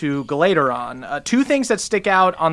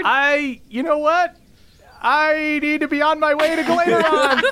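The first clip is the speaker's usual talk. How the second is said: high, flustered hurry to leave; laughing, eager to escape; awkward bard voice; 10 semitones higher than usual. Acted. NPC bard brushes them off.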